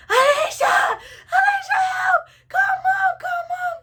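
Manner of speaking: very high screamed plea, hammed up; desperate yelling. Child being hit calls Alicia, imitated desperate yell. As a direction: shriek for your sister to come help. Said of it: "I'm yelling at Alicia".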